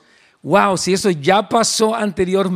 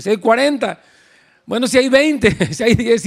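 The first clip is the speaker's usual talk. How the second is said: haggling; 5 semitones higher than usual. Retold or imitated. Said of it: "sigue como regateando allí".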